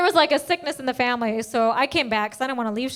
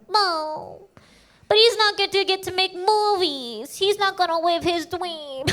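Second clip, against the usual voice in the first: mock pity; 4 semitones higher, slower than usual. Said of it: "one person like".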